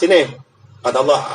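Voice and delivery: ordinary talk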